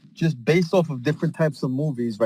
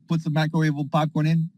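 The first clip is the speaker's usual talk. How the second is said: ordinary delivery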